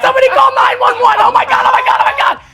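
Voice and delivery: high, loud, frantic screaming mom; panicked mom. Retold or imitated. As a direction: shriek it like a terrified wife. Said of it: "she starts freaking out like".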